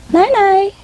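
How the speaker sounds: very high parrot goodnight; imitating a parrot. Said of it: "they say it for me".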